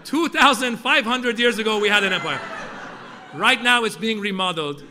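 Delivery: put-on loud, proud Persian-accented lecture; proud dad, Persian accent. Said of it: "And my dad would say".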